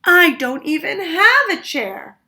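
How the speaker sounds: put-on a little boy shouting his complaint; shouted. A narrator's reading. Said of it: "shouted little Chan".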